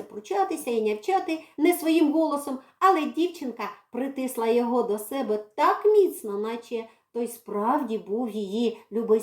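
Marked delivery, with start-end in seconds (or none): none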